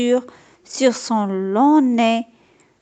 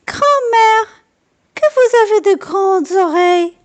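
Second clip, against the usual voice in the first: put-on much higher, amazed little girl; wondering girl; 10 semitones higher, 4 dB louder than usual. A narrator's reading. Girl staring at the wolf in bed, amazed. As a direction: marvel at her big ears.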